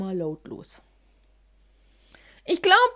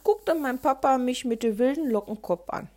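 usual speaking voice

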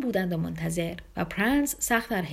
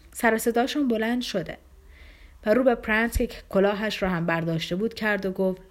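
ordinary delivery

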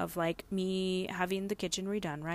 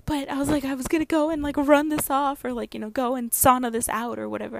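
loud, high, insistent objection; worked-up protest; 8 semitones higher, 8 dB louder than usual. Retold or imitated. Wife objecting to husband sending her home, loud and flustered. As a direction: push back loudly, high and flustered.

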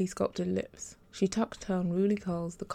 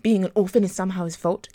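loud, heated cut-in; heated interruption; 9 dB louder than usual. Acted. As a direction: throw his words back at him, heated. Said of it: "Gabriella interrupted heatedly".

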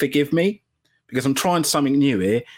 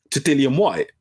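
normal talking voice

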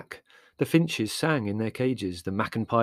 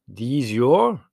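gruff official voice; curt foreign customs officer; customs man; 3 semitones higher, 9 dB louder than usual. A narrator's reading. Customs officer quizzing Mother at the shed, gruff. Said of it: "he inquired".

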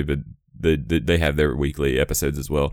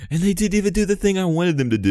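high complaint; 14 semitones higher than usual. Real, not acted.

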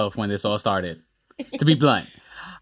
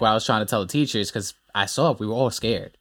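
ordinary talk

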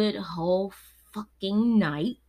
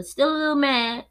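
higher and louder, lingering irritation; still a little mad; 7 semitones higher, 4 dB louder than usual. Real, not acted. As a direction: let the grudge show. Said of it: "I'ma say that".

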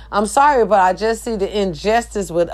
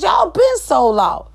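high slow shout; 8 semitones higher than usual. Real, not acted. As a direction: yell it up high, angrier. Shouted higher repeat.

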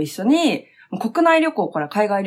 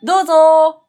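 bright welcome; 7 semitones higher, 7 dB louder than usual. Acted. Inviting guest in.

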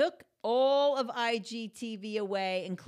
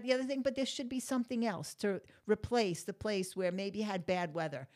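ordinary talk, nothing marked

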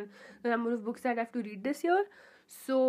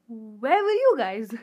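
mock scolding call to listeners; playful loud call; 8 dB louder, slower than usual. Real, not acted.

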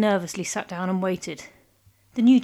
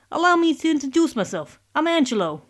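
higher, louder godfather voice; Italian mob boss; 7 semitones higher, 4 dB louder than usual. Acted. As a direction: play a smooth mafia don. Mob boss introduces himself.

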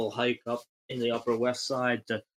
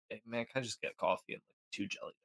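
much softer, quick little order; meek mumble; 11 dB quieter, faster than usual. Retold or imitated. Teller ordering after the booming guy, meek quiet mumble. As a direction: shrink away after the big act.

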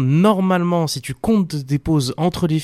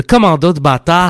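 Quebec accent; 5 semitones higher, 8 dB louder than usual. Shown as a demonstration. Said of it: "tu l'as pas dit avec l'accent".

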